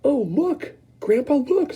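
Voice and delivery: put-on high boyish voice, eager; a boy tugging his grandpa to look; excited Charlie. Charlie calling Grandpa to the glass, high and eager. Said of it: "said Charlie".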